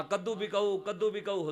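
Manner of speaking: lifted, ringing street cry. Retold or imitated. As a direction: mildly raise the voice as if selling wares. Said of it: "آواز اور اونچی ہو گئی".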